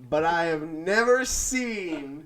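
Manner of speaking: slow, drawn-out proclamation; deliberate conspiracy reveal, played for laughs; mock-dramatic. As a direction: stretch every phrase for comic suspense. Mock-solemn case that guest is Bond.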